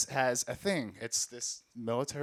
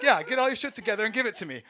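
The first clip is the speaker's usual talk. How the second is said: high, loud, fast joking demand; mock robber; 10 semitones higher, 8 dB louder, faster than usual. Narrator messing with the stranger, mock robber. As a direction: push the bit further.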